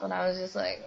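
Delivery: ordinary delivery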